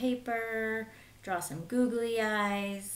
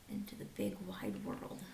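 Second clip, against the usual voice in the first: whispered, much softer; soft whispered wonder; hushed whisper; 14 semitones lower, 10 dB quieter than usual. Acted. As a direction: breathe it like a secret adventure. Hushed about leaving home.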